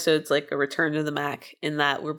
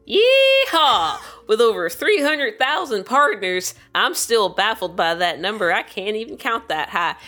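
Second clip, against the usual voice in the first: put-on raised, hollering old miner twang; old-timey cowboy voice; 4 semitones higher, 3 dB louder than usual. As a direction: keep up the folksy cowboy miner pitch. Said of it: "my old-timey, uh, cowboy miner voice".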